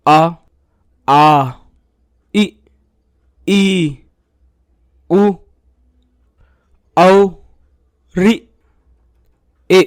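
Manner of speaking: each vowel said alone, with a pause after each; slow, clear roll call of the Hindi vowels; reciting vowels. Shown as a demonstration. Teacher recites the vowels for pupils, one at a time. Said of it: "इन मात्राओं को बहुत ही आसान भाषा में समझते हैं".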